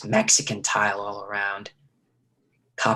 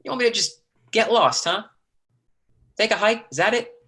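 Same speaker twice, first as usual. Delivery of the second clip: sarcastic taunt; 9 semitones higher than usual. Acted. Lee taunting Austin about leaving.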